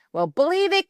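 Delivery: sneering taunt. A narrator's reading. Queen bee gloating while ripping the gym suit. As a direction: gloat at her.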